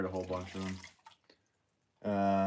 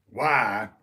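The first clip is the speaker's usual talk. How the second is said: loud, explosive movie-star bark; Al Pacino; 2 semitones higher, 8 dB louder than usual. Pretend. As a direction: burst out in a hammy actor impression. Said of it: "Do Al Pacino".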